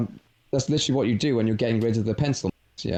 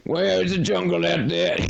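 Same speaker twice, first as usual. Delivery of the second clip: higher, overdone Southern American voice; exaggerated American accent; 6 semitones higher than usual. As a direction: play a Louisiana swamp guy. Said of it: "my American accents are very exaggerated".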